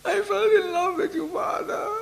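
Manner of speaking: put-on very high, soft, near-crying girlish voice; mock tearful little-girl confession. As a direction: play the shy smitten girl. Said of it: "She was like a little girl".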